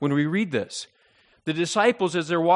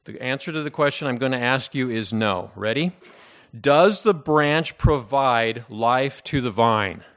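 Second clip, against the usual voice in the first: ordinary talk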